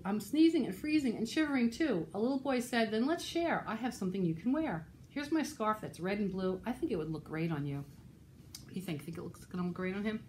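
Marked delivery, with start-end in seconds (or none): 0.1-7.8 put-on lively, rhythmic read-aloud for children; animated storytime reading; picture-book narration. A narrator's reading. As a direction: read a rhyming story to little listeners.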